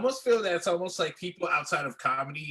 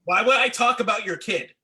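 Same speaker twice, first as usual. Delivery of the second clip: louder, higher, incredulous; a raised voice of disbelief; incredulous retort; 5 semitones higher, 6 dB louder than usual. Real, not acted. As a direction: push back hard, exasperated. Exasperated retort to advice.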